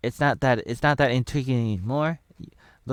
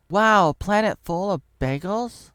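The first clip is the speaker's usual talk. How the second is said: high slow character voice; 9 semitones higher, 5 dB louder, slower than usual. Acted.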